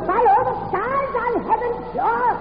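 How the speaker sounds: high, slow wailing witch voice; witch's curse. Acted. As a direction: wail the curse like a doomed witch. Witch cursing from her cell.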